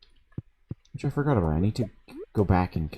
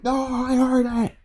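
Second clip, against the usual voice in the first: high mock whiny yell; hammed-up high-pitched tantrum. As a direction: imitate the housemate insisting shrilly. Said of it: "And he's like".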